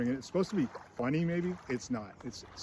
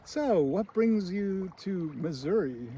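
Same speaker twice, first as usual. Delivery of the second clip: higher, slow acted question; woman detective; 5 semitones higher, slower than usual. Woman detective questioning the husband, slow higher imitation. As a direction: imitate a detective making small talk, drawn out. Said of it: "the woman detective says something like".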